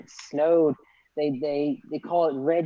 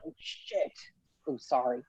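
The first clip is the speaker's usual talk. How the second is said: 压低声音的惊呼; hushed retold curse; 3 semitones lower, 7 dB quieter than usual. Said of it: "I'm like".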